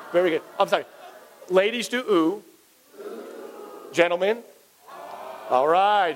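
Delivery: usual speaking voice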